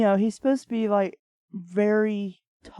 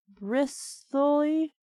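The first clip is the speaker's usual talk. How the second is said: ordinary delivery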